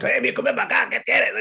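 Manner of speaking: gruff booming dad calling out in Spanish; loud-talking dad. Retold or imitated. Imitating his loud dad calling a friend over. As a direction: bark it out like a brusque father. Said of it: "very loud".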